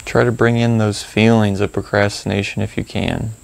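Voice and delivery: calm, spacious phrases with long pauses; gently prompt, leaving room to notice each feeling; guided meditation. Acted. Guide leading listeners to reflect on procrastination, calm meditation delivery.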